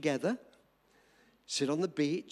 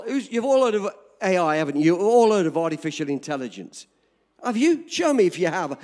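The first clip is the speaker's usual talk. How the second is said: much louder, insistent call to the crowd; loud challenge; 10 dB louder than usual. Real, not acted. Preacher asking congregation who has heard of AI, loud challenge. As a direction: demand an answer from the whole audience.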